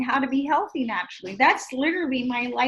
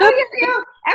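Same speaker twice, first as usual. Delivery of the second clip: laughing; 11 semitones higher, 9 dB louder, slower than usual. Real, not acted. Laughing recalling Girl Scouts.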